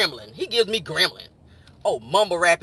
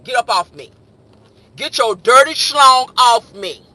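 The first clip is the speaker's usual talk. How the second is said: loud, stretched-out holler; club holler; 4 semitones higher, 11 dB louder, slower than usual. Shown as a demonstration. Woman to her pushy ex in the club, hollered. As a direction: holler it slowly over blasting music. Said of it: "you gotta holler at his ass in the club".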